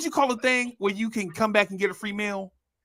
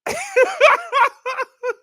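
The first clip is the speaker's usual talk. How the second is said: high shrill mock laugh; 6 dB louder than usual.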